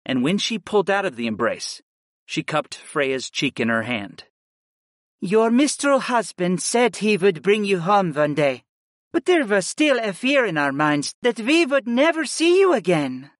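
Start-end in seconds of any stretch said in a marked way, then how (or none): 5.2-13.3 put-on higher, tender older mother; loving chieftain's wife; gentle mother voice. Retreya speaks to daughter.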